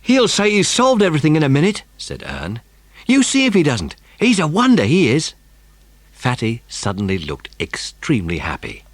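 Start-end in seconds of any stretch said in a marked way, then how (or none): 0.0-5.3 put-on higher, louder boyish boast; proud of his friend; eager boy's voice; Ern's voice. A narrator's reading. Ern voiced in story.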